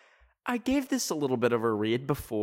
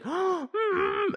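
gasp, then very high hum; high-pitched mock panic noise; mock alarmed squeal. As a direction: play-act alarm with a squealed "mm". Gasp and squeal at flooded street.

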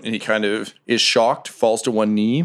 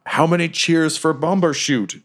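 slow, higher sentry call; guard's challenge; 6 semitones higher than usual. Acted. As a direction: call out the challenge slowly into the fog. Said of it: "and they, they".

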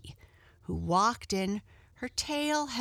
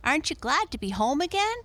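put-on high, fast, louder boy's question; boy crying out; 9 semitones higher, 5 dB louder, faster than usual. A narrator's reading. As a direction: ask it eagerly, quick and high. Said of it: "cried a boy".

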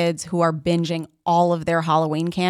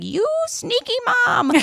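put-on very high squeaky kid voice; mock child voice. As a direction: squeal it like a cheeky little kid. Quoting prank video kid.

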